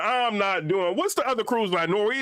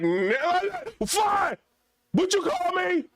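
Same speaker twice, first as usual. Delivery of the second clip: mock outrage; 6 semitones higher, slower than usual. Answering the fly's insult.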